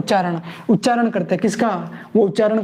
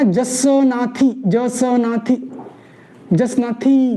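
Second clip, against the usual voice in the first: "Jasnathi" stretched out syllable by syllable; slow drilled word; much slower than usual. Shown as a demonstration. Teacher drilling the sect name for students, very slow. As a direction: spell out "Jasnathi" slowly for the class. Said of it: "teacher drills pronunciation slowly".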